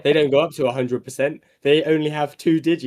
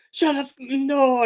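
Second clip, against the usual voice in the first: ants voice; 12 semitones higher, slower than usual. Pretend. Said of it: "they're like".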